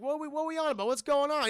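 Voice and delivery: high, agitated questioning; acted bewilderment. Driver asks the others what is happening, bewildered. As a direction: play a confused friend demanding to know.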